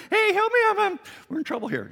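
very high, loud mock scream for help; hammed-up shrieking panic over a radio; panicked screaming. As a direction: squeal it out, frantic and fast. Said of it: "start screaming into the radio".

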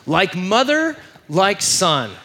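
high, loud, drawn-out mock outrage; hammed-up exasperated outburst; losing it. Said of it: "they just lose it".

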